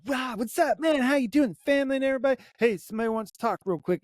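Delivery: high-pitched, bubbly hello to a friend; imitating a busy, upbeat greeting; retold hurried greeting. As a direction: act out a passing, excited catch-up. Acted hurried greeting at gathering.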